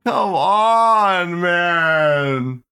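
high, drawn-out "come on"; exasperated plea. Real, not acted. Streamer fed up with posters, exasperated plea. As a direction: groan it out, begging them to quit.

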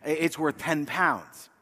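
softly breaking the bad news in a light British accent; gentle British expert letting them down, slowly; kind British verdict. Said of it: "They do it so kindly with a British".

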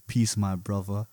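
low, slow, soft solemn greeting. Retold or imitated.

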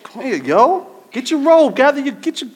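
high, loud, bossy street call; mock rough summons. Pastor mimicking how he would never call Jesus over, bossy and loud. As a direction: bark it like a pushy buddy calling someone over. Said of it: "I wouldn't be like".

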